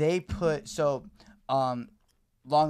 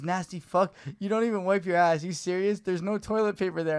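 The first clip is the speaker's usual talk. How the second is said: loud furious rant, pitched higher; angry texter; 6 semitones higher, 6 dB louder than usual. Retold or imitated. Stranger texting about shit in his toilet, loud angry rant. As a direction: tear into him like a disgusted stranger. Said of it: "And he goes".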